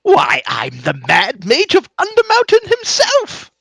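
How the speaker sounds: high, loud, triumphant proclamation; theatrical wizard crowing his own title; grandiose Mad Mage. Acted. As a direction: announce yourself with a showman's flourish. Mage grandly announces himself.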